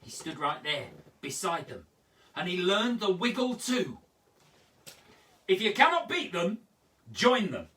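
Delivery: put-on bouncy, high, loud poem reading for kids; sing-song children's poem, full of fun; lively poem performance. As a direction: perform the rhyme with big energy.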